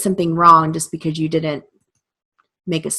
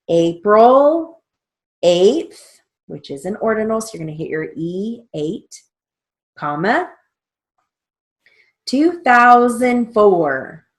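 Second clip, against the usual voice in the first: dictating; 4 semitones higher, 5 dB louder than usual. Shown as a demonstration. Dictating dates for read back.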